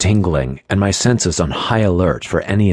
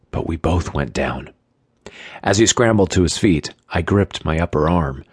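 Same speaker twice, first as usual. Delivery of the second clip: plain reading voice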